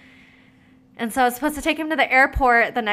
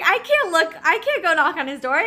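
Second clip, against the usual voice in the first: higher, louder flustered protest; panicked refusal; 8 semitones higher, 6 dB louder than usual. Retold or imitated. Retelling her panicked refusal.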